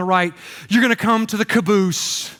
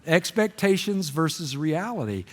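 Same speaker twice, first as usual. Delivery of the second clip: "Expectations versus reality" said softer and lower; quiet theme line; 4 semitones lower, 6 dB quieter than usual. Acted. Preacher repeating his theme as a rueful punchline, hushed.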